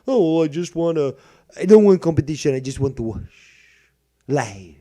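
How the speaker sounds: mocking whiny viewer. Mocking a viewer who wants no competition, loud whine.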